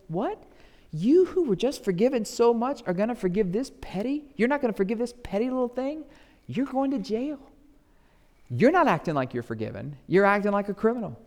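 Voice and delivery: high, loud royal outrage; a king rebuking a servant; high indignant king condemning the servant; furious king. Acted.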